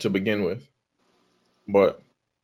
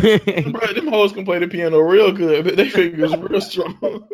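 laughing; 5 semitones higher, slower than usual. Real, not acted. Laughing at own joke.

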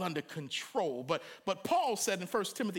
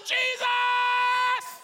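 much higher, louder shout; full shout; 7 dB louder than usual. A narrator's reading. Blind man shouting over the ushers to Jesus. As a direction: yell the name with everything. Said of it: "He got louder".

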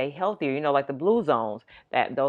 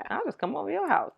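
playful inner voice; 12 semitones higher, 5 dB quieter than usual. Retold or imitated. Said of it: "I'm thinking".

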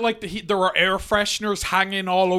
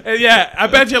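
normal talking voice

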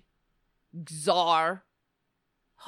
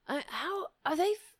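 normal talking voice

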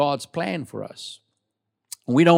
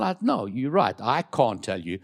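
ordinary delivery

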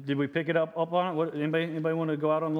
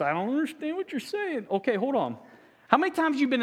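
high, silly confused voice; mock confused listener; 9 semitones higher than usual. Preacher mimicking the silent congregation, high and baffled. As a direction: play a baffled churchgoer.

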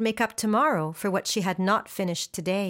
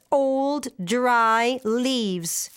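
plain reading voice

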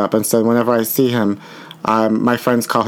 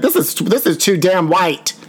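slow, higher outraged put-on voice; mock outraged moviegoer; 5 semitones higher, slower than usual. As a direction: play a scandalized moviegoer storming out. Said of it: "Be like".

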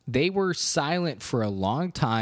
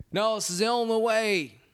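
much higher, mock-heroic sacrifice line; hammed-up noble goodbye as Ed Harris; playfully bad Ed Harris; Ed Harris impression; 9 semitones higher than usual. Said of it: "my Ed Harris impression".

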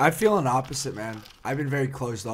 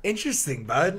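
teasing; 7 semitones higher, 4 dB louder than usual. Real, not acted. Teasing repeat of interesting.

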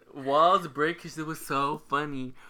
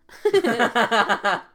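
loud forced laugh; a hammed-up, put-on laugh; fake laugh; 7 semitones higher, 10 dB louder than usual. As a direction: push out an obviously fake laugh. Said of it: "that was not a genuine laugh".

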